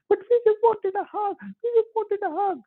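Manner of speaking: put-on high squeaky little-child voice; childlike plea. Rich man's children begging father, squeaky childlike plea. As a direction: plead for a hug like a tiny kid.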